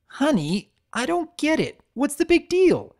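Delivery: high nervous stammer. A narrator's reading. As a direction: stammer it out, flustered. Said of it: "the stallion stammered".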